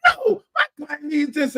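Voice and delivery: imitating the other lawyer. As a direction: play the rival lawyer pleading. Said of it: "And the other divorce lawyer".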